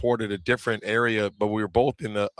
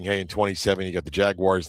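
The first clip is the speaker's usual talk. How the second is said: ordinary delivery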